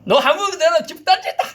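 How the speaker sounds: high shouted threat; threatening shout. Retold or imitated. Preacher retelling his threat to the spirit, shouting. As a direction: yell it as a threat. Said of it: "내가 그랬었어요".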